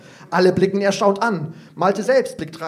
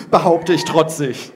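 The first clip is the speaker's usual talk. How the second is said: plain reading voice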